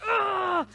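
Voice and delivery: loud, high roaring cry; fierce wordless roar; demonstrated roar. Shown as a demonstration. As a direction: let it rip in one short roar. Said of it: "let her roar".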